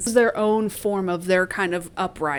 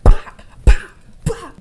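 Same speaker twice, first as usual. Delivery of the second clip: percussive "bop" hits; imitated punching sounds; 9 semitones higher, 14 dB louder, slower than usual. Host acting out Cinna's beating, imitated punch sounds. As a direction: punch out each "bop" as a blow.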